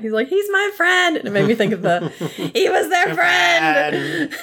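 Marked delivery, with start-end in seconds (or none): 0.3-1.2 mock yell. Ed yells at Jack. 2.5-4.3 high, very slow crying wail, laughing; sobbing shout parody. Retold or imitated. To the viewer recalling a meme, slow mock-sobbing shout.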